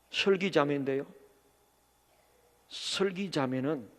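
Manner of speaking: barely able to get the words out; hesitant grief. Retold or imitated. Pastor telling the deacon his daughter died, low, soft and halting. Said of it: "말을 못 하겠더라고요".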